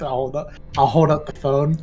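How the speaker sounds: ordinary talk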